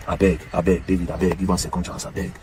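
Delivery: soft, low-voiced begging; earnest pleading. Real, not acted. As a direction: beg quietly, dropping the voice. Pleading with [name] for second chance.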